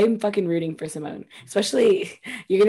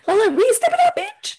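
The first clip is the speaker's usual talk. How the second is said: high-pitched, exasperated urging; mock exasperated outburst. Viewer urging a failing contestant, high mock exasperation. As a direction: cry out as if coaching a flop.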